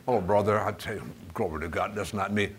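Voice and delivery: low, hushed mimicked churchgoer; soft, low imitation of a believer; mock denial.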